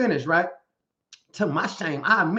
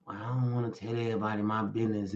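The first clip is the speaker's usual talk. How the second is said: mock evasive mumble; 8 semitones lower, 9 dB quieter, faster than usual. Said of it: "I bet you ain't".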